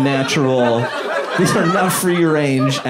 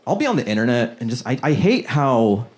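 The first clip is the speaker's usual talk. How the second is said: usual speaking voice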